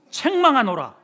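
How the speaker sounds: loud, high proclamation of rebuke; proclaimed rebuke. Acted. Preacher booming God's rebuke to the congregation. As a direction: thunder the word like a prophet.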